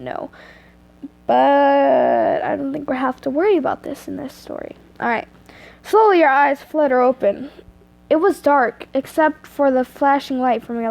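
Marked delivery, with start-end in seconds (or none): none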